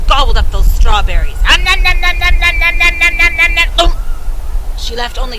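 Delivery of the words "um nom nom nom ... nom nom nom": loud, higher nom nom chewing noises; greedy munching. Acted. Stepmother gobbles the strawberries with loud munching.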